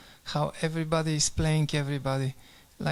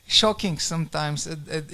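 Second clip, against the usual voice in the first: ordinary talk, nothing marked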